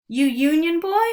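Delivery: high, loud mocking jeer. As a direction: taunt the boy, loud and high. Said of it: "one jeered".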